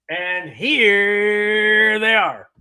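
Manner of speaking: slow, drawn-out game show reveal; old-school announcer reveal; dating game host. Retold or imitated. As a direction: stretch it out like a TV host unveiling the ladies. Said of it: "that's how they used to do the dating game".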